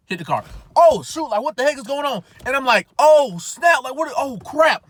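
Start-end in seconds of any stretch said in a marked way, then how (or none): 0.8-2.2 high, loud startled "oh snap, what is going on"; panicked surprise. Retold or imitated. Young driver reliving the crash, shocked and loud. 3.0-4.9 high, loud startled "oh snap, what is going on"; panicked surprise. Retold or imitated. Young driver reliving the crash, shocked and loud.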